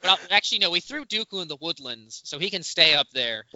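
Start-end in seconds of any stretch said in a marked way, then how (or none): none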